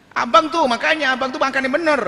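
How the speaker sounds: a wife scolding her husband to eat. Acted. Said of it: "sama istrinya". (istri mengomel pada suami dengan nada tinggi)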